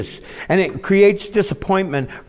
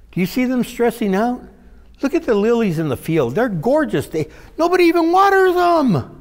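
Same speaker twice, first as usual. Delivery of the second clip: much higher, marvelling; awed wonder; 9 semitones higher than usual. Acted. Preacher marvelling at the lilies to the congregation.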